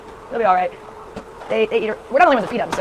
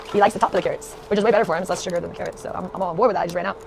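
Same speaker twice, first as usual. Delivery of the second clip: normal talking voice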